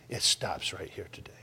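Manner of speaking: hushed, breathless whisper. Acted. Preacher vowing to the congregation in a whisper. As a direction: drop it to a whisper, like a secret vow.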